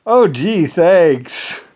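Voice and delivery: high, loud mock gratitude; sarcastic thanks. Thanking the ally who just slaughtered his troops, sarcastic. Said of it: "I was like".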